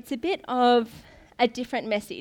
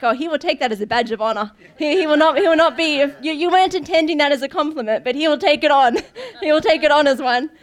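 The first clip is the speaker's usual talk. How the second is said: amused reply; 4 semitones higher, 7 dB louder than usual. Retold or imitated. Amused retold reply about Dad.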